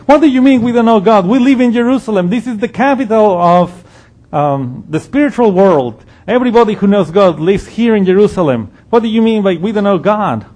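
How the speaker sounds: can you believe this man; offended people in Jerusalem protesting Jesus; indignant disbelief. Retold or imitated. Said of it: "these people are like".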